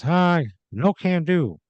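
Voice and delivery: high, slow, smug brush-off; Michael voice. Acted. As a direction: turn her down breezily, pitched high and drawn out.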